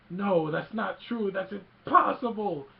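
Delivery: anguished mock denial, high and loud; slow, building cry of disbelief; Luke. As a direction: wail it like a horrified movie hero. Acting out Luke's horrified denial.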